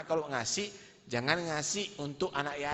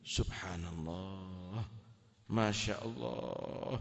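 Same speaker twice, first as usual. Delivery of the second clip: slow awe; 4 semitones lower, 5 dB quieter, slower than usual. Acted. Husband's slow astonishment.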